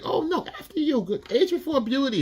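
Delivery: falsetto fake-sweet mockery; sugary high voice hiding the knife; catty club woman. Club girls taunting the older woman about her son, falsetto fake-sweet. Club women mocking in bathroom.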